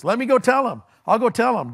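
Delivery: volunteer keenly, pitched well up; eager volunteer. Acted. Acted eager runner begging to go.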